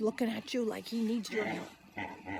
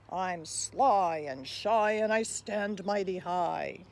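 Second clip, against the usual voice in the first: louder, slow and proud rhyming voice; antelope reciting; 9 dB louder than usual. Acted. Antelope proudly introducing itself to children, slow proud recital. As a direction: stand tall and declaim it with pride.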